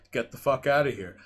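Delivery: ordinary talk, nothing marked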